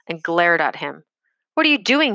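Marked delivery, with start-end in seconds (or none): 1.5-2.2 high, loud angry shout. A narrator's reading. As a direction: bark it at the boy like an officer. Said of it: "he shouted".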